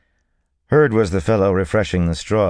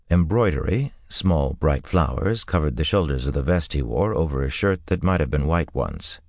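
usual speaking voice

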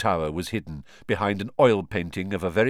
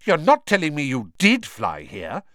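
severe; 6 semitones higher, 6 dB louder than usual. A narrator's reading. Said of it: "sounding almost as severe as Professor McGonagall".

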